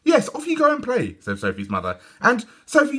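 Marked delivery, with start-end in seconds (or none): 0.0-1.2 high cheery mum voice; high bright mother; mother's voice. A narrator's reading. 2.2-3.0 high cheery mum voice; high bright mother; mother's voice. A narrator's reading.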